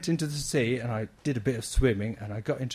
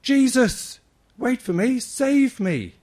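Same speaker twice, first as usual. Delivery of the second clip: high, loud call to Jesus; retold shout; 12 semitones higher, 9 dB louder, slower than usual. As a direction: cry out to be noticed. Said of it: "and he shouted".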